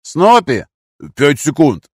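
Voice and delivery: high, loud hail and reply; urgent calling. A narrator's reading. Comrades shouting to each other before attack, loud calls. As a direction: call out across the boat. Shouted exchange under threat.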